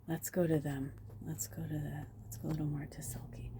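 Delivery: soft, low murmur to oneself; hushed musing. Real, not acted. Reader feeling out the move to Selkie, hushed musing. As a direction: drop the voice and think aloud. Murmurs while choosing where to go.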